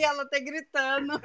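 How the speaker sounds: ordinary talk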